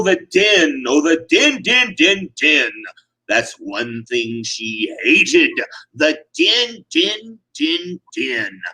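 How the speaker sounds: usual speaking voice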